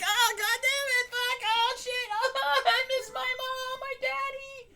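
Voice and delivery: put-on very high crying-kid voice; whiny kid voice. Homesick scout in the woods, very high whiny wail. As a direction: wail like a lost little camper. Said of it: "I was like".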